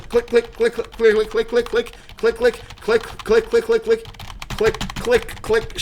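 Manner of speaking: high, quick mock keyboard clicks; imitated clicking. Shows chat his frantic clicking in a high voice. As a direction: rattle off the clicks like mashing keys. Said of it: "how loud my clicking is".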